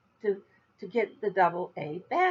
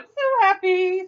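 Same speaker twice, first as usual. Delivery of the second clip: happy; 10 semitones higher, 6 dB louder, slower than usual. Real, not acted. Happy about the yarn.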